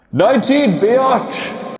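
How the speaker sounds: high, loud hype call; excited shout of the episode number; hyped shout. Pretend. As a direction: yell it like a hype man.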